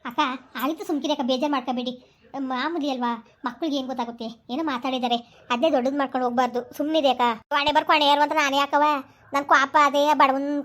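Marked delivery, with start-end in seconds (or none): 0.1-7.2 softer, lower soothing words to a grieving sister; gently calm her down, hushed; soft consoling. Acted. Neighbour consoles the upset sister. (ಮೃದುವಾಗಿ ಸಮಾಧಾನಪಡಿಸುವ ಧ್ವನಿ)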